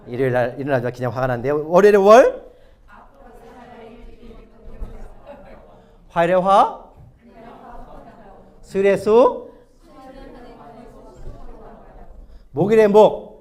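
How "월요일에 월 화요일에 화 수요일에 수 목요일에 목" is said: loud, raised cue to the congregation; calling out headings. Real, not acted. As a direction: announce each day like a chant leader. Leading congregation through day headings. (크게 선창하듯)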